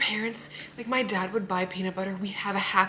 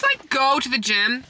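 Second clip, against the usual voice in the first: usual speaking voice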